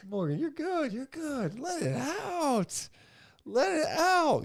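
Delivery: cooing consolation. Real, not acted. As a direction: coo it to a crying friend. Coaxes co-host to cry.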